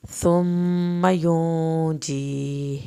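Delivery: slow, loud, drawn-out tajweed chant; Quran recitation. Shown as a demonstration. Teacher recites aloud for students, slow loud chant. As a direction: chant each word slowly for the class to follow. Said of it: "میم کی شد پہ ایک الف کے برابر".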